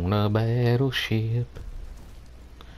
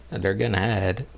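normal talking voice

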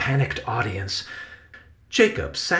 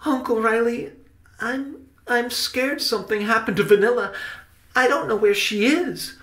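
high, worried character voice; anxious and high-pitched, halting at first; scared; 11 semitones higher than usual. A narrator's reading. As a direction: confess a fear in a much higher voice. Worried Benny confesses his fear.

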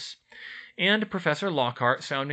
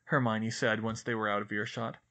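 ordinary delivery

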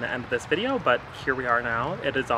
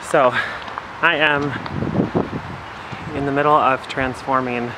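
breathless, panting between words; out of breath; 5 dB louder than usual. Real, not acted. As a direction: talk while catching your breath. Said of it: "sorry I'm a little out of breath".

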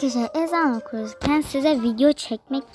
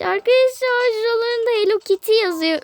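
much higher, thrilled squeal; excited squeal; 11 semitones higher than usual. Real, not acted. Delighted squeal to viewers at Hello Kitty stuff.

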